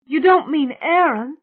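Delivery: high, loud, horrified question; crying out. A narrator's reading. Mona fears the victim is Aaron, crying out. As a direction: cry it out in dread. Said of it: "Mona cried".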